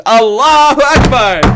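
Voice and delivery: loud, high delighted cry; excited cheer. Real, not acted. Cheering the joke.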